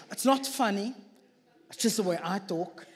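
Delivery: ordinary talk, nothing marked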